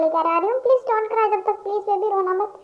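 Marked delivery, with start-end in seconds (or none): none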